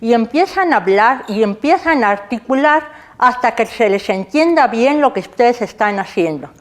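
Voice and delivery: talking around a pencil held in the mouth; muffled speech past a pencil gripped in the mouth. Shown as a demonstration. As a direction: articulate with a pencil between your teeth. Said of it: "Lo voy a hacer sin miedo al ridículo".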